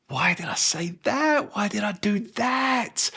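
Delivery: high, soft self-questioning wail; morning-after regret. Acted. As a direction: groan at yourself in a high, small voice.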